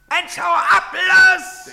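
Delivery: loud high shout. Acted. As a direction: yell at him to clear off. Soldier chasing a man off.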